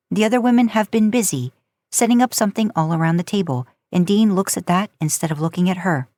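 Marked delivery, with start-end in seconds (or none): none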